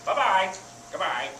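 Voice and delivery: lightly imitated parrot farewell; a hint of parrot voice, mimicking its goodbye; parrot saying goodbye. Parrot to groups as they leave, lightly imitated goodbye. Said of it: "he says goodbye".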